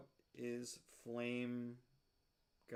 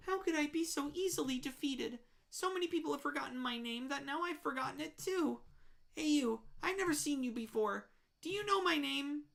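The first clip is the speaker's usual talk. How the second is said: higher, sing-song voice of a forgotten monster; a higher, plaintive creature voice; high lonely monster voice; 12 semitones higher, faster than usual. Acted. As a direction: sound wistful and lost, pitched up.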